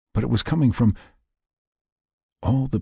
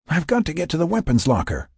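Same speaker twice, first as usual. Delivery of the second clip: desperate inner voice; 9 semitones higher than usual. Acted. Frank's desperate thought, voiced high.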